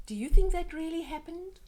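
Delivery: high, loud, quick question; excited question. Acted. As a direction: toss the question out to spark wonder. Reader asks child a question.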